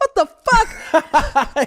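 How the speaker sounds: high, drawn out, laughing what the fuck; stunned disbelief. Retold or imitated. Son learning his mom is deaf, slow high laughing shock. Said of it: "I'm like".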